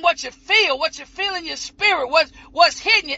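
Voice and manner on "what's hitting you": usual speaking voice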